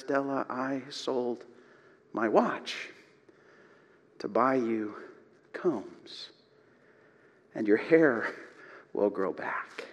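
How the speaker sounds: quietly choked, understated sobbing; through sobbing. Retold or imitated. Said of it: "through sobbing".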